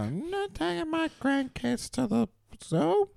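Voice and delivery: high, slow granny voice; dotty absent-minded old lady. Acted. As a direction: play the senile grandmother. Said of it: "They go with the senile route".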